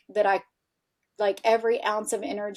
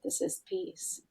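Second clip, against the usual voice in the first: breathless whispered awe, barely voiced; awed whisper; 11 semitones higher, 11 dB quieter than usual. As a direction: whisper it as if stunned by sudden peace. Awestruck realization at the other side.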